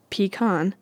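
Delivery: "pecan" said PEE-kahn; pronunciation PEE-kahn. Shown as a demonstration. Vlogger listing options, pecan as PEE-kahn. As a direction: give "pecan" a long ee, then kahn. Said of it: "Do you say".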